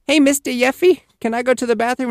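put-on high small-child voice; imitating a kid with childish speech; little kid asking. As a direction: ask the teacher like a young child.

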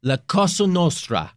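hammed-up Italian mobster accent; Italian mafia accent. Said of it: "very Italian".

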